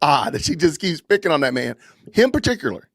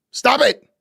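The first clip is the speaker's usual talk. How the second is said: a sharp, higher, exasperated stop it; exasperated command; 7 semitones higher than usual. Real, not acted. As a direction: cut them off, fed up. Ranting at TPUSA to stop.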